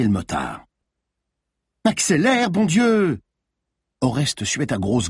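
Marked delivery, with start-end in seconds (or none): 1.8-3.2 high, exasperated command; urgent shout. A narrator's reading. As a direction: shout at the driver to hurry. Yelling at driver during chase.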